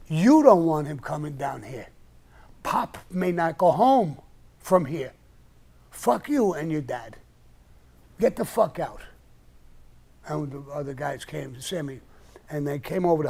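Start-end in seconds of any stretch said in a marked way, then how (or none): 0.1-9.0 louder, slower threatening snarl at her; furious comeback, loud and deliberate; loud retold threat. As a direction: throw her threat back in her face, hard and loud. He threatens her back, enraged.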